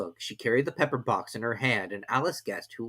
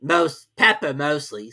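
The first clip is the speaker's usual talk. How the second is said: blunt cook; 4 semitones higher, 8 dB louder, slower than usual. A narrator's reading. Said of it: "said the cook".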